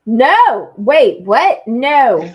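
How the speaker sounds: loud, quick "no, wait, what?"; loud protest. Real, not acted. Malta host refusing to take Amy, loud protest. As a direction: shout down the idea.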